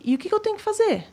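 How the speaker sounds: high-pitched, quick question; retold eager question. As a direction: ask it fast, pitched well up. Retelling her eager question to bandmate. (pergunta ansiosa, aguda e rápida)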